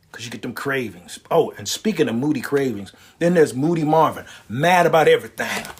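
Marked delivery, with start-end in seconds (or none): none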